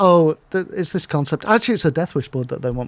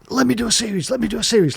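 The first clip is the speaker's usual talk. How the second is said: mock nagging; 6 semitones higher, faster than usual. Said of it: "just nagging me".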